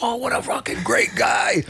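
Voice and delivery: very high, squealed mock adoration; awestruck fan gushing. Retells his awestruck fan reaction.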